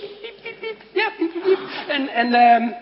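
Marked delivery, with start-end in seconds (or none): 0.0-0.8 tiny high squeaky mouse peeps; high soft mouse squeaks; mouse squeak imitation. Shown as a demonstration. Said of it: "En dat klinkt als". 1.2-1.6 tiny high squeaky mouse peeps; mouse squeak imitation. Shown as a demonstration. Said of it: "En dat klinkt als".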